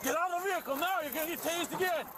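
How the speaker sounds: high, urgent yelled command; shouting. Real, not acted. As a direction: yell it at her, urgent. Deputy yelling at resisting driver.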